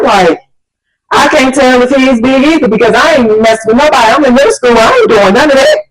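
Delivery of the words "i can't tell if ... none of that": high-pitched, flustered self-defence; retold protest, pitched well up; indignant protest. Girl insists she ain't messing with nobody, indignant.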